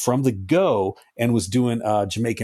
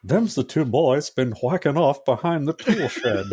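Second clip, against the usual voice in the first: Beavis and Butthead neighbour; 3 semitones higher than usual. Acted. Neighbour telling on two boys behind the shed, cartoon impression. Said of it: "the dude from Beavis and Butthead".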